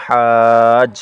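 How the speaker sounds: drawn-out recitation of হাজ; long madd. Shown as a demonstration. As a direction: stretch হাজ for four counts. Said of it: "উপরে মোটা চিহ্ন".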